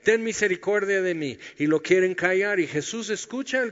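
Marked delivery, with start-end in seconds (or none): 0.0-1.4 higher, louder plea for mercy; a blind beggar calls out over the crowd; crying out. Retold or imitated. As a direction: call out to be heard over a crowd. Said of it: "empieza a gritar".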